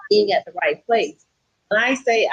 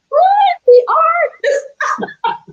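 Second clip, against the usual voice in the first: much higher, louder shocked cry; shocked outcry; 7 dB louder, much slower than usual. Retold or imitated. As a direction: yelp it in disbelief. Shocked by news of moving.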